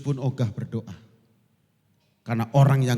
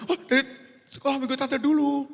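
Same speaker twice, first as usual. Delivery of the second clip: high, innocent reply; aunt answering; 13 semitones higher than usual. Retold or imitated. Sunday school aunt innocently citing her teacher, high. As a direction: answer as if obvious.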